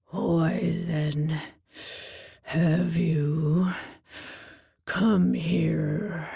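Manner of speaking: low, breathy monster demand; low Minotaur voice. A narrator's reading. Minotaur interrogating the girl, low and heavy. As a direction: push the question from deep down.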